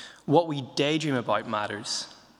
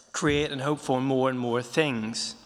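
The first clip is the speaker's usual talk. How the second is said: usual speaking voice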